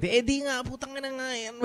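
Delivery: hammed-up tough-guy Tagalog; mock rough Tagalog. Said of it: "picture myself when I'm speaking Tagalog".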